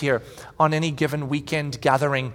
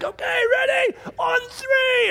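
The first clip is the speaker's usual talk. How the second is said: rallying shout; slower than usual. Retold or imitated. Dad rallying kids to lift.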